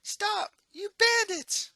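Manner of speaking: high, loud cry at fleeing thieves; shrill-free full-throated yell for help; woman yelling. Acted. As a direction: shout after the bandits. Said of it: "he hears a woman yelling".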